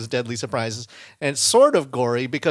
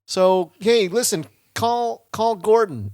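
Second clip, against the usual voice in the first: imitated call for help; 8 semitones higher, 4 dB louder, slower than usual. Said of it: "he calls out to her for help".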